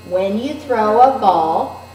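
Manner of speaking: put-on slow, steady read-aloud alongside a young reader; teacher reading aloud together with Riley; reading along with a child. Shown as a demonstration. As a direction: keep pace with the child, smooth and even. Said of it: "let's read it with Riley".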